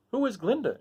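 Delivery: high, curious Scarecrow. Acted. Scarecrow asking the soldier. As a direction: straw man asking. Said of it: "inquired the Scarecrow".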